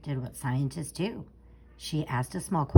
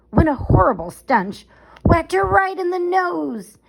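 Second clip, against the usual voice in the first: dramatic burst; 10 semitones higher, 9 dB louder than usual. A narrator's reading. Sudden stench in story.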